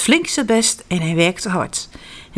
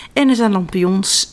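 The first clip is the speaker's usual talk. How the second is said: ordinary delivery, nothing marked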